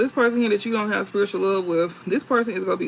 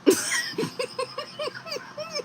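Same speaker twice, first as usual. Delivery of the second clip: a sudden high squeal of laughter; 5 dB quieter than usual. Real, not acted. Reader laughing at her own joke to viewers, a high squealing laugh. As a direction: crack up at your own gun joke.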